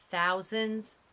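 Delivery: usual speaking voice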